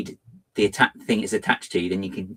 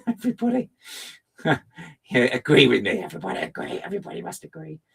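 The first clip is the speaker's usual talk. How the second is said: faster, hushed mock decree; mock commanding; 7 semitones higher, faster than usual. Streamer playfully ordering chat to agree with them. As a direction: demand agreement like a playful tyrant.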